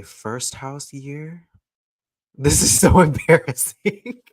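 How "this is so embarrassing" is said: embarrassed giggle; laughing. Real, not acted. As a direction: laugh through the word. Laughs at herself.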